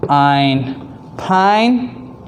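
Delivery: "p-, ein, pine" split into onset and rime, then blended; sounding out. Shown as a demonstration. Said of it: "number three is p".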